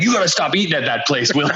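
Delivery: lightly voiced annoyed teammate, not real anger; mock snap. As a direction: snap at him half-laughing. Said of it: "Rod finally snapped".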